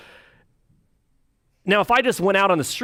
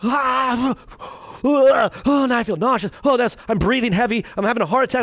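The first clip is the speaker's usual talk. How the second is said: higher, hammed-up groaning sufferer; mock distress; 7 semitones higher than usual. Preacher mocking a tortured man in a marathon, higher and overdone. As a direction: play the tortured runner gasping and complaining.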